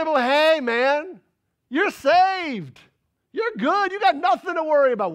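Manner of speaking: high, loud, breezy mock comfort; the glib believer, mocked loudly; mock reassurance. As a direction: brush off all worry in a hammed-up way.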